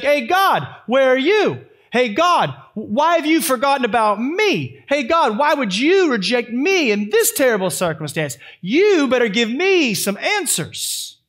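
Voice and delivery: hammed-up huffing at God, demanding answers; imitating an angry believer scolding God; demanding, angry prayer. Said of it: "with a bunch of huff and puff and anger".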